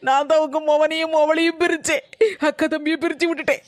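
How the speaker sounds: crying sarcasm; tearful bitter admission. Acted. Daughter-in-law to mother-in-law, crying and bitter. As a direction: sob through the mock confession.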